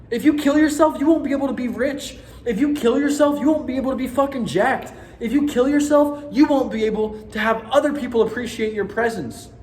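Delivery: loud, high, heated venting; got a little mad. Real, not acted. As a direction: hammer the point home, fired up and stressed. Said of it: "I got a little mad there".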